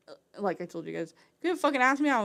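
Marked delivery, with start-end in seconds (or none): 1.4-2.2 higher and louder, exasperated; frustrated outburst. Real, not acted. Venting to viewers about family, a loud frustrated burst. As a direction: blurt it out in frustration.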